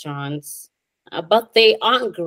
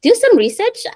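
emphatic; 7 dB louder than usual. Real, not acted. Mock advice after laughing.

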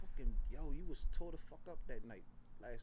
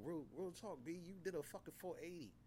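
ordinary talk, nothing marked

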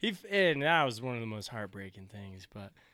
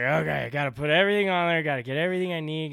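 frantic rush; 3 semitones higher, 7 dB louder, faster than usual. Retold or imitated. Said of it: "just like".

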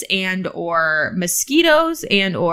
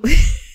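loud laugh; 8 semitones higher, 9 dB louder than usual. Real, not acted. Bursts laughing.

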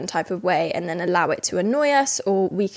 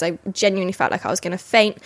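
usual speaking voice

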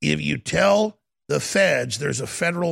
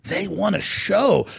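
ordinary delivery

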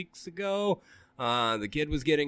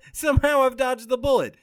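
smug laughing; 12 semitones higher, 6 dB louder than usual. Retold or imitated. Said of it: "and then I think".

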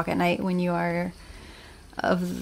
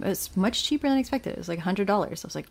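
ordinary delivery

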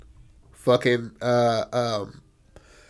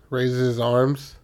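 normal talking voice

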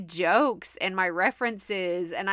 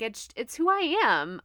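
ordinary delivery

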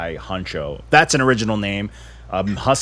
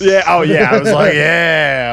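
hyped loud yeah; excited; 6 semitones higher, 9 dB louder than usual. Retold or imitated. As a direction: shout it out, pumped up. Excited retelling, loud high.

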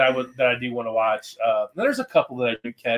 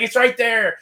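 mock excited insistence; 9 semitones higher, slower than usual. Quotes investigator insisting on sighting.

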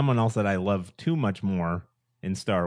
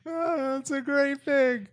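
slow, very high delighted coo; gushing approval; slower than usual. Real, not acted. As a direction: gush in a drawn-out high voice. Gushing over the pick.

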